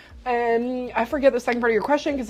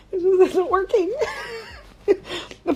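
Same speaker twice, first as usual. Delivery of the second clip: laughing; 11 semitones higher, 3 dB quieter than usual. Real, not acted. Laughing through the burn.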